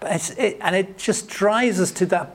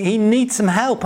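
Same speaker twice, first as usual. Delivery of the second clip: ordinary talk, nothing marked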